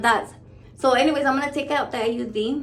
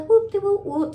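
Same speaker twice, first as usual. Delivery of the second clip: happy Erica; 9 semitones higher, 4 dB louder than usual. Retold or imitated. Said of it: "happy Erica".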